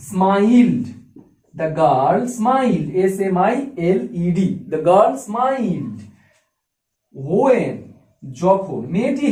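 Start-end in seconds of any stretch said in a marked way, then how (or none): 0.1-2.9 words stretched very slowly for writing; very slow dictating voice; slow dictation. Shown as a demonstration. Teacher dictates example. 4.7-7.7 words stretched very slowly for writing; very slow dictating voice; slow dictation. Shown as a demonstration. Teacher dictates example.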